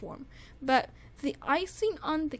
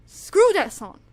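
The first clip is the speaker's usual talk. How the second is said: mock outrage; 3 semitones higher, 6 dB louder, slower than usual. Mock dismissing a song version.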